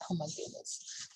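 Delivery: soft unvoiced exclamation; whispered aside. Real, not acted. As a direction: breathe it in wonder. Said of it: "reader aside".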